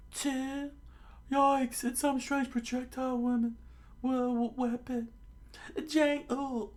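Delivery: very high falsetto yelp; high, squeaky freak-out; panicked cartoon voice. Freak-out and count acted in story.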